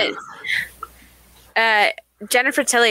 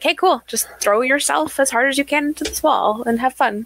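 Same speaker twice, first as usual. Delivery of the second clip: lightly mocking, higher-pitched casual urging; mock breezy instructions; 5 semitones higher than usual. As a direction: toss it off like it's no big deal, pitched up. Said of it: "a lot of them are just like".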